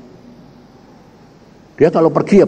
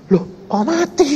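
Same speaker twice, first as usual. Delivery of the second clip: surprised; 11 semitones higher than usual. Retold or imitated. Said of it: "Loh, dia bilang".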